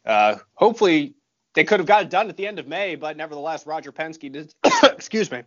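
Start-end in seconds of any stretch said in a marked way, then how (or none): 4.8-5.4 coughing, choking on food; choking on donut. Real, not acted. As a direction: excuse yourself through a choke. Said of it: "I'm choking on the donut that I just ate".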